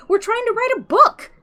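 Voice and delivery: high, loud, pleading yell; desperate yell. A narrator's reading. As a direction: Ford begging the Vogon guard not to eject them, high loud yell. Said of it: "Ford yelled".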